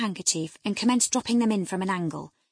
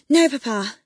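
high, loud gasped denial; startled gasp; 5 semitones higher, 9 dB louder than usual. A narrator's reading. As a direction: blurt it out breathless and caught. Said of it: "she gasped".

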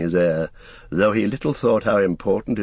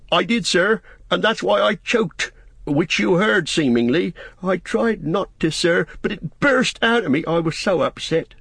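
high, emotional servant voice; upset Sam confessing; 11 semitones higher than usual. Acted. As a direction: confess distress in a high pitch.

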